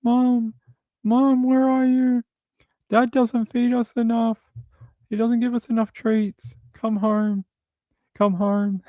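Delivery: high, pleading little cat voice; cats' voice. Retold or imitated. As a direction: beg like a cat missing its mom. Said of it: "Rory was like".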